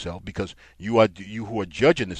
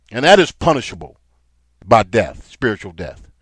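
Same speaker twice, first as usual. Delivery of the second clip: loud, hard-hit sermon delivery; forceful preaching; 3 semitones higher, 9 dB louder than usual. Real, not acted. Preacher warning listeners against closing eyes to wickedness, forceful preaching. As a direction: preach it out loud, driving the key words.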